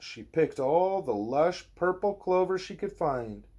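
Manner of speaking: usual speaking voice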